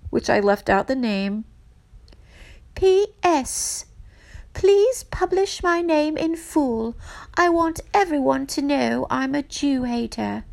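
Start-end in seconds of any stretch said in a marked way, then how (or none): 2.7-10.4 high, hammed-up English accent; bad English accent. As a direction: read it out in a put-on English accent, pitched up. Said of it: "I'll do my bad English accent".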